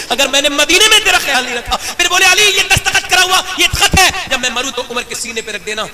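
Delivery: loud impassioned preaching; full-force preaching. Real, not acted.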